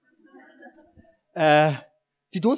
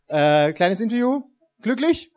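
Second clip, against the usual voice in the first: game show host; 4 semitones higher, 5 dB louder than usual. Pretend. Mock winner interview.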